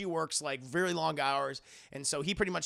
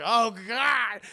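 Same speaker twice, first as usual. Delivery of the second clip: loud, higher, repulsed cry; disgusted shout; 4 semitones higher, 9 dB louder than usual. Retold or imitated. Priest walking into a filthy room, shouting in disgust. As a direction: recoil from the stench out loud. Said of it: "and he's like".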